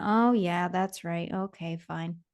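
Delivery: shoulders slumped, letting the dream go; deflated giving up. Retold or imitated. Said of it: "my shoulders kinda slumped".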